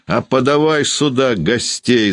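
high-pitched defiant cry; shouting. A narrator's reading. Landlady calling for the guests, defiant high shout. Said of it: "закричала Ершова".

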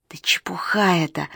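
angry hissing whisper; hissed whisper. A narrator's reading. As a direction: hiss it under your breath. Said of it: "прошипел Гуньшань".

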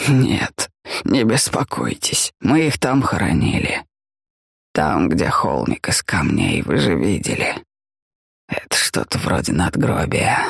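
put-on low, slow tired old man. A narrator's reading. Helmut voiced in the story.

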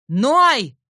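loud yelled name; shouting. A narrator's reading. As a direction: bellow the name. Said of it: "снова орёт отец".

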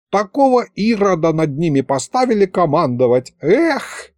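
put-on high, chatty old wizard voice; an excitable old sorcerer prattling on. Old wizard Mirovid speaks. (высокий голос болтливого старого колдуна)

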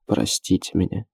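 hushed, tearful apology; understated choked plea; choked by tears. A narrator's reading. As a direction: beg forgiveness quietly, holding back tears. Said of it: "чувствуя, как его душат слёзы".

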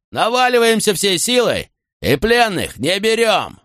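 high, slow, commanding address to troops; rallying battle cry. Acted. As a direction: rouse the men for the charge. Centurion rallies troops before battle. (громкий призыв к бою)

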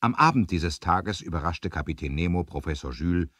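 ordinary talk

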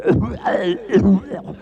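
tos fuerte y aguda; tos fingida. Acted. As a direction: tose a propósito para distraer. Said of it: "el tuberculoso que hace". (tos exagerada del tuberculoso)

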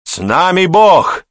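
high, loud, slow crowd cheer; joyful roar. A narrator's reading. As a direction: roar it back gladly as a whole garrison. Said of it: "повторили радостным рёвом все". (радостный рёв)

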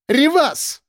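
very high-pitched woman's cry. A narrator's reading. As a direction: call him off in a high, contained shriek. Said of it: "снова закричала Элла". (крик высоким голосом)

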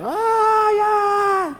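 high mock-drunk wailing "ay, ay"; drunken wailing cry. Preacher imitating the singer's drunken cry, high wailing. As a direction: wail it like a heartbroken drunk at the cantina. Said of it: "Ahí veis cuando grita él".

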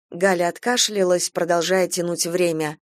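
ordinary delivery, nothing marked

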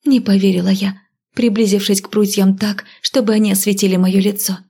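usual speaking voice

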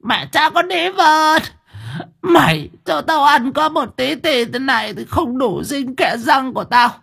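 put-on very high, drawn-out shrieking old woman; raging old grandmother. A narrator's reading. Grandmother demanding food from her daughter, shrieking high. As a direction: screech it out as a cursing grandmother.

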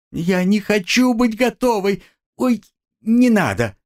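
high, desperate begging; frightened pleading. A narrator's reading. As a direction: beg high and frantic. Girl pleads in fear.

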